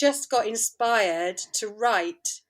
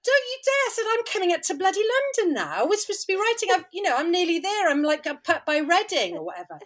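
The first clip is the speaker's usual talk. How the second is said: much higher, fast retold telling-off; mock indignant; 7 semitones higher, faster than usual. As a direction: scold him in playful outrage.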